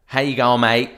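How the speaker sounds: mock Australian. AI email quoted in an overdone Australian accent. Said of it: "turned the email to".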